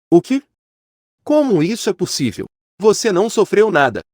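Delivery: shocked disbelief. A narrator's reading. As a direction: jump up in pitch, taken aback. Villain shocked that hero is unhurt.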